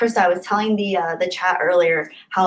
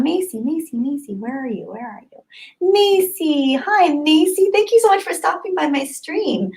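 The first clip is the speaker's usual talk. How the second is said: high, sing-song cooing greeting; gushing welcome; 9 semitones higher than usual. Real, not acted. Streamer welcoming a viewer, high and gushing. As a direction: coo her name like greeting a pet.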